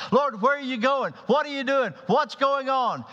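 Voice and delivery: high, loud, insistent questions; impatient Peter. Acted. Peter pestering Jesus, high and loud.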